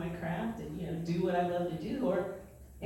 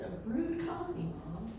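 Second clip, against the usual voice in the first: daughter repeating; 5 semitones higher, 6 dB quieter than usual. Retold or imitated. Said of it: "She said".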